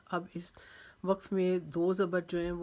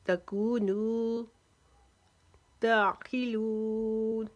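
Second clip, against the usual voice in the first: slow, louder Quranic chant with pauses; full recitation; 4 semitones higher, 6 dB louder, much slower than usual. Shown as a demonstration. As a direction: recite each word stretched, stopping between. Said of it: "इस आयत number बासठ को अब वक्फे के साथ पढ़ते हैं".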